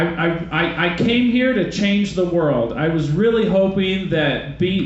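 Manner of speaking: usual speaking voice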